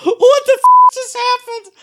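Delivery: high, loud, startled "what the"; startled outburst. Real, not acted. Reacting to his friend's glitching headset, high startled outburst. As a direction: blurt it out in sudden shock, very high.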